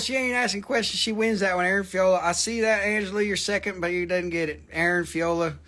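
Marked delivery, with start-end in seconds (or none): none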